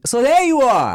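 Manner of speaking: high, loud goofy greeting; Austin Powers impression. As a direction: quote the movie at a stranger. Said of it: "I'm like".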